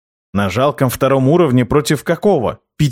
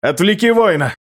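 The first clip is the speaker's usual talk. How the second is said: higher, sharp shouted command; barking order; 7 semitones higher than usual. A narrator's reading. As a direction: bark the order mid-fight. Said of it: "рявкнул я".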